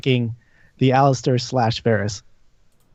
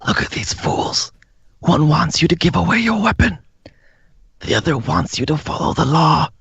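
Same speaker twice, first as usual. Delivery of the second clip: hoarse, unvoiced tempting whisper; sinister whispered coaxing; whispering tempter; 2 semitones higher than usual. Tempting voice offers Titus power.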